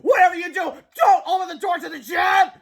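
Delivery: high, loud warning shout; desperate cry. A narrator's reading. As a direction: beg them to stop, at full voice. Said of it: "cried George".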